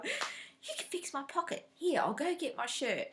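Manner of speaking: put-on bouncing like a kid with a new toy; mock-excited husband begging a favour; husband's eager voice. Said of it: "and he's going".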